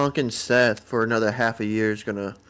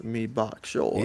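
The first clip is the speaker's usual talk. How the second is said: softer, slow mock Jamaican "Me bok choy"; quiet Jamaican accent; 5 dB quieter, much slower than usual. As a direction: mumble the Jamaican line back. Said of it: "in a Jamaican accent".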